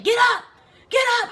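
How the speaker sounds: high, loud barked order; drill sergeant's yell. Retold or imitated. Drill sergeant over a falling recruit, loud high yell. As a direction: imitate a sergeant screaming orders.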